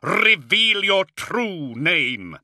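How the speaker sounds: high, slow shouted command; ringing order to a demon; forceful demand. A narrator's reading. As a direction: demand it with each word drawn out. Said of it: "he demanded".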